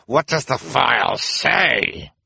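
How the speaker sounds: high, slow, threatening demand; terrible voice. A narrator's reading. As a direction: demand an answer, drawing out each word. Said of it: "demanded in a terrible voice".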